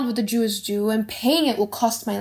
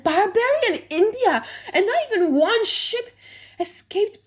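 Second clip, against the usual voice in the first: high, rising alarm at each port; shocked disbelief; 8 semitones higher than usual. Acted. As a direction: pile up the disbelief, voice climbing. Portia shocked by shipwreck news.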